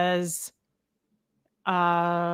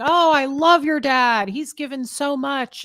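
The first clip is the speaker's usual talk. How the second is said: higher and slow, mock sugary praise; gushing churchgoers; 6 semitones higher, 4 dB louder, slower than usual. Churchgoers praising her absent dad, hammed-up gushing. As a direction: imitate fawning church members.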